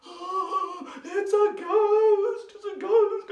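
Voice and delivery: high sing-song mock fright, stretched out slowly; hammed-up scared wail for the kids; terrified cry. Disciples crying out at a ghost on the water, high mock-terrified sing-song. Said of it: "they were terrified and they cried out".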